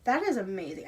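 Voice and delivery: ordinary delivery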